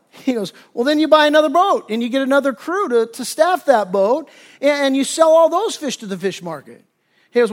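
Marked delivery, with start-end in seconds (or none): 0.7-6.8 higher, louder, acted-out enthusiasm; acted keen persuasion; eager sales pitch. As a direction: pitch the scheme like an eager businessman. Businessman excitedly pitches a bigger plan.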